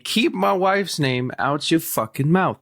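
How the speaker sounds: half-hearted Will Smith impression; Will Smith quote retold. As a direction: lightly replay the angry line. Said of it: "yell at Chris Rock saying".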